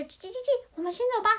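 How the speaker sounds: put-on eager baby chick in a picture book; chirping little chick. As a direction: chirp like a chick, then urge the others on.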